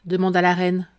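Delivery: plain reading voice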